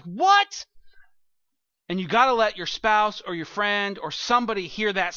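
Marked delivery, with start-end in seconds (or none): 0.0-0.7 much higher and louder, thrilled double-take; hearing a great song while driving, excited; excited what. Retold or imitated.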